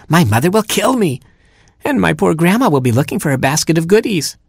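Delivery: high-pitched, fretful scolding; indignant cry. Acted. Little hero scolding the mockingbird for misleading him, indignant and high. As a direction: pile up the complaints in a high voice. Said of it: "he cried".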